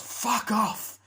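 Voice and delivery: very high, loud dismissal; shrieked mock brush-off; acted angry shout. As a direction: yell it through a closed door. Answering the doorbell, retold.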